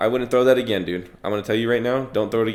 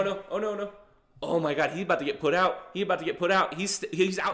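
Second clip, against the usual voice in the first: much higher, hushed tension; tense excitement; 9 semitones higher, 6 dB quieter, faster than usual. Real, not acted. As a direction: hold your breath as he goes down. Fighter about to be knocked out.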